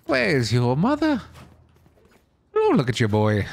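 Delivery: lilting put-on Irish brogue; Irish accent. Shown as a demonstration. Showing friends an Irish accent, playful lilt. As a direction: do a kinda-Irish accent, swooping up and down. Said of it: "I could do a kinda like Irish".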